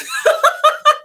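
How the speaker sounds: helpless high laugh; a startled giggle breaking out; high burst of laughter. Real, not acted. Laughing as the personal reading pops up mid-stream.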